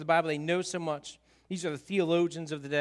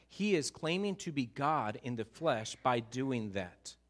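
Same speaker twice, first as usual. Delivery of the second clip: normal talking voice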